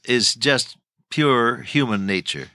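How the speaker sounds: normal talking voice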